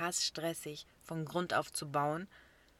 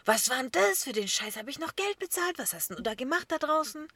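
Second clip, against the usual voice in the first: higher, louder scolding father's rant; imitating angry dad; 6 semitones higher, 4 dB louder than usual. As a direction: mimic the father bawling her out. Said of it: "mein Vater mich so angemotzt und sagt so". (wütender Vater, nachgeahmt und angemotzt)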